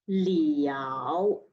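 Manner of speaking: "liao" low dip then rise, third tone. Shown as a demonstration. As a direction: sink "liao" then lift. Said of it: "Line number sixteen".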